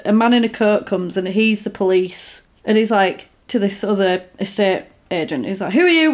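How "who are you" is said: higher, louder who are you; demanding challenge. Retold or imitated. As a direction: challenge a stranger sharply. Policeman confronts estate agent.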